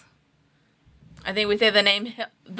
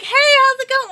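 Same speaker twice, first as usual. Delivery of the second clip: a light, much higher chirpy hello; mock cheerful greeting; 3 dB louder than usual. Retelling Chuck's cheery hello to Ned, mildly mock bright. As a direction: play the undead girl greeting him brightly. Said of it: "And then she's like".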